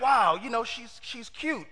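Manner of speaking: soft, slow, dreamy admiration; a quiet mock-swoon over his cousin; smitten. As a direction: breathe it out like he's lovestruck. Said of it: "he's like".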